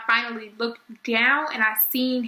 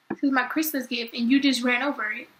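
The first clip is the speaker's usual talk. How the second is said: ordinary talk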